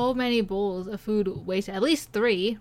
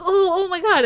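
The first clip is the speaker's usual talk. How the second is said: mock shocked gasp; 9 semitones higher, 5 dB louder than usual. Waiter's shocked reaction retold.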